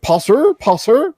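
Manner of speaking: high, questioning echo of "Poser?"; mock disbelief. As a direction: imitate someone baffled by the word. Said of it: "You're like".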